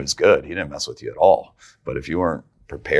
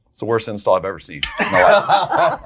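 loud, higher-pitched mock verdict; imitating his dad; 5 semitones higher, 5 dB louder than usual. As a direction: do your dad's blunt put-down, breaking into a laugh. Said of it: "And that's how he talks".

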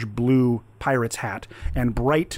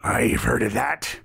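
pirate captain. Acted. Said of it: "And this voice goes out".

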